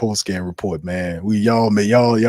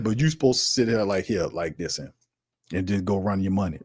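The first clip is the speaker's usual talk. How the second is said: hushed mock; 3 semitones lower, 9 dB quieter than usual. Mocking fans who sit quiet.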